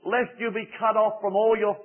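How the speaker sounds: high, loud impassioned preaching; fervent preaching. Acted. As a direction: plead with the whole congregation. Altar call at full force.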